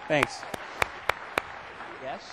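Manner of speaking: usual speaking voice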